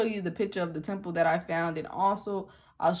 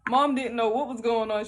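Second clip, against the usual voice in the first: ordinary delivery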